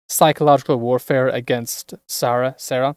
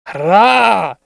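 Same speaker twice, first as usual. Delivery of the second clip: a loud rising war cry; battle yell; 5 semitones higher, 9 dB louder than usual. Acted. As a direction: roar it as you charge. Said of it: "Shout to do and scream in a Scottish accent".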